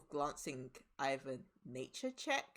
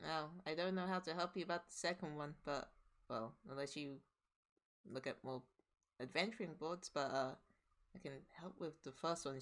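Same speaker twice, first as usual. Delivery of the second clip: softer, subdued reply; quiet barmaid; 6 dB quieter than usual. Acted. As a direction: answer softly, subdued.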